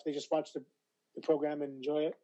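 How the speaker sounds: ordinary talk, nothing marked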